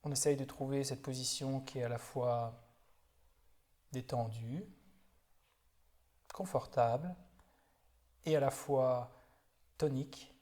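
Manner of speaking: calm, unhurried body-scan guidance with long silences; guided meditation. Real, not acted. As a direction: guide them gently through the body, leaving space after each phrase. (méditation guidée, voix calme et posée)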